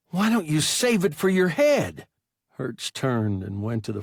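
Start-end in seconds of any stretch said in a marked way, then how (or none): 0.1-2.1 put-on higher and louder, a whining injured boy; high, whining young pilot sneering at his captain. A narrator's reading. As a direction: whine it at him like a hurt kid. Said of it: "that high, whining voice".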